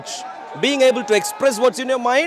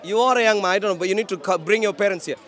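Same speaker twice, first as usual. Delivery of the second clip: ordinary delivery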